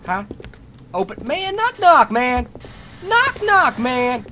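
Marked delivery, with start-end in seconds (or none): 1.2-4.2 high, loud pushy knock knock; mock knocking caller. Gamer teasing monster behind door, high pushy knock. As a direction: pound on the door playfully.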